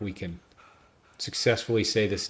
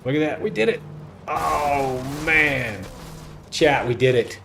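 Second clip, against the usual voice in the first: loud, raised celebratory cheer; triumphant excitement; 7 semitones higher, 8 dB louder, slower than usual. Real, not acted. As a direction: celebrate a big win to the chat, loud and high. Celebrates pulling off the drift.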